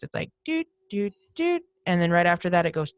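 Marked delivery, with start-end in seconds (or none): 0.4-1.6 light imitated beeping doots; squeaky beeps. Describing weird noises on the recording, mild squeaky doots. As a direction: mimic little squeaking beeps. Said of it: "these weird squeaking noises".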